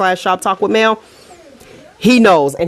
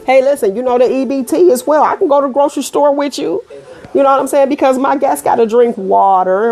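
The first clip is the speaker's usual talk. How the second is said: playful joke; 7 semitones higher than usual. Real, not acted. Joking about EBT grocery trip.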